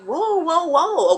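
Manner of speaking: high, startled backing-off whoas; mock alarm. Man with a gun in his face, mock alarm. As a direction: play at being caught off guard.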